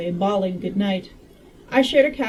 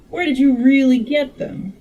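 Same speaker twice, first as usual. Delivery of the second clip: higher, louder, shaky grasp of Southern; attempted Southern accent; 4 semitones higher, 5 dB louder than usual. Medical man questioning time traveler, attempted Southern accent. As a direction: have a go at a Southern accent, imperfectly. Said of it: "I can't do a Southern accent".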